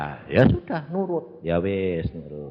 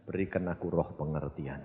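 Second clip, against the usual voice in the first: hushed prayer; 9 semitones lower, 14 dB quieter than usual. Retold or imitated. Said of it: "saya berkata".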